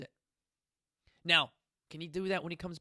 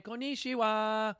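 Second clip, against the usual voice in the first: higher, slow Japanese hello; drawn-out greeting; 5 semitones higher, slower than usual. Acted. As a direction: stretch it out like a showy greeting.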